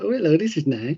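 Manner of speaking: mock Geordie. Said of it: "and he was like".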